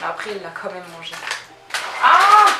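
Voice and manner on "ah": sudden high, loud shriek; real startled yelp, high and loud; startled scream. Real, not acted. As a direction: cry out in alarm. Startled by her dog at treats.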